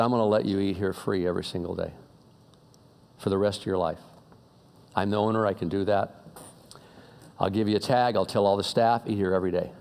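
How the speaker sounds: hushed, kindly reassurance, retold; compassionate rich man. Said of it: "had real compassion for this guy".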